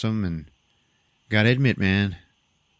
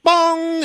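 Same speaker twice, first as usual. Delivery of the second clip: high, loud "bong" of an impact; loud imitated clang; 7 dB louder than usual. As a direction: ring it out like struck metal. Just goes like.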